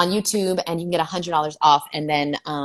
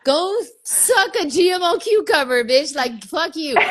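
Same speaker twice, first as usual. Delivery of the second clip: high, loud mock tirade; hammed-up furious yelling; mock angry rant; 10 semitones higher, 4 dB louder, slower than usual. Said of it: "And I'm like".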